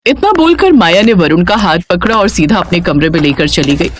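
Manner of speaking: plain reading voice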